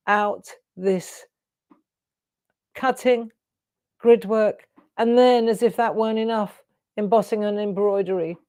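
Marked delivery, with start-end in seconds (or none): none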